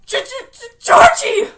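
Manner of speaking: high, loud stammering scream; stutter, then shriek the name. Acted. Bill facing his dead brother, stammering into a shriek. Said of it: "Bill shrieked".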